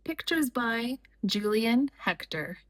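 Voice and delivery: ordinary delivery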